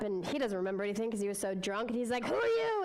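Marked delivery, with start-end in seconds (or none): 2.2-2.8 rude, much higher and louder snap. Acted. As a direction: bark it at the stranger. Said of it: "And he's really rude".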